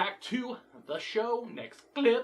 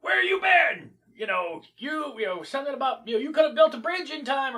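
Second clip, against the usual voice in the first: guard yelling; 5 semitones higher, 5 dB louder than usual. Retold or imitated. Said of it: "a guard dude is all like".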